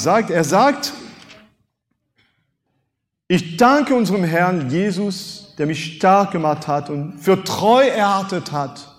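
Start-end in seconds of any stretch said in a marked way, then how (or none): none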